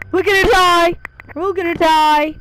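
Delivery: very loud shout of doom; panicked yell. Real, not acted. Player about to lose the game, yelling. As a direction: yell it out in a panic, full volume.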